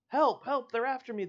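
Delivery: high, loud desperate shout; panicked cry for help. A narrator's reading. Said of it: "the man cries".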